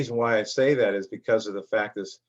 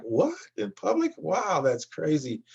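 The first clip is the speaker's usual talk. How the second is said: shocked disbelief; 5 semitones higher, 3 dB quieter, slower than usual. Retold or imitated. Hearing a couple swear in public, mock shocked. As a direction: squeak up high on public, then slow down in disbelief. His own reaction to the couple.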